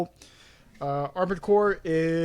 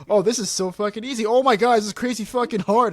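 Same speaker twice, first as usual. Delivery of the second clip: higher, louder mock alarm; excited gamer; 6 semitones higher, 5 dB louder than usual. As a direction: swing from bored to panicked. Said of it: "it's like".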